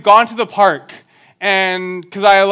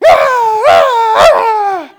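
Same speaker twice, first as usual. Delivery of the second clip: tantrum cries; 10 dB louder, much slower than usual. Retold or imitated.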